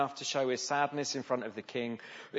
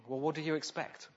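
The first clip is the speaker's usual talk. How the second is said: soft, gentle reply to the king, a bit quieter; gently; 2 semitones higher, 5 dB quieter than usual. A narrator's reading. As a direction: say it ever so gently, almost kindly. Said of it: "ever so gently says to the king".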